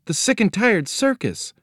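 high-pitched echo of disbelief; shocked girl. A narrator's reading. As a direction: repeat it way up high, as an alarmed child. Girl repeats it in alarm.

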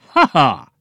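loud sarcastic "ha ha"; humorless snort laugh. A narrator's reading. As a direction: scoff without any humor. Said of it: "snorted without humor".

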